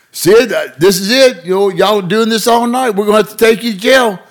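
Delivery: high, loud weary warning; tired lawman laying down the law; exasperated cop. Retold or imitated. Cop warns Sid.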